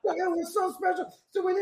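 high, laughing delivery; delighted laughter. Real, not acted. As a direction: say it through leftover laughter, pitch way up. Laughing at his own story.

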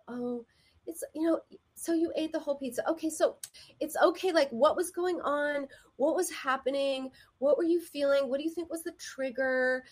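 high, gently curious imitation; soft and nice. Group member kindly asking another about a slip, soft and nice. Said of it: "their voices were very soft and nice".

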